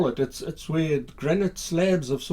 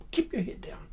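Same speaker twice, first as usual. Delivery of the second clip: hushed warning; 5 semitones higher, 6 dB quieter than usual. Acted. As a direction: whisper it urgently from behind cover. Soldier's order while hiding.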